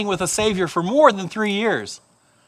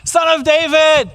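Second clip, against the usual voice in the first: high, drawn-out desperate call; louder shout; 7 semitones higher, 4 dB louder, slower than usual. Acted. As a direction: shout it out slowly over the crowd. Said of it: "he probably even said it louder".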